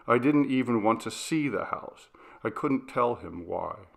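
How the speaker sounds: plain reading voice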